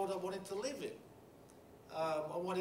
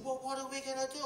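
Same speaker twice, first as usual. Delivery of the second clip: coworker's eager question; 10 semitones higher than usual. Retold or imitated. Coworker asks urgently.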